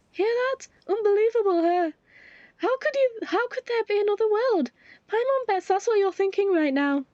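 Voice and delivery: mock the listener's disbelief; Paimon voice. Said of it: "it is now".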